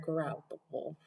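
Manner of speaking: ordinary delivery, nothing marked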